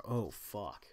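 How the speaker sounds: ordinary delivery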